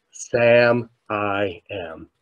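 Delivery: slow, soft, spaced-out words; hushed. Retold or imitated. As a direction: let it land quietly, word by word. Soft payoff after retold thank you.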